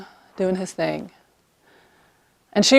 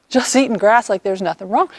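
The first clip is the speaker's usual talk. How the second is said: ordinary talk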